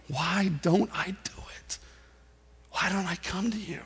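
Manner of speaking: very soft, nearly whispered confession; hushed prayer. Acted. Preacher praying to God about not coming to him, hushed.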